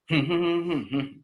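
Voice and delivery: high wordless hum starting at the peak; hummed question tune. Shown as a demonstration. Teacher humming the question's melody for students, hummed question tune. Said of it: "con la entonación más alta".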